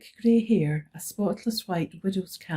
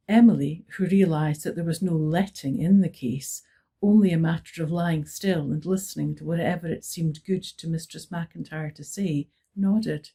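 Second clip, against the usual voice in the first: ordinary delivery, nothing marked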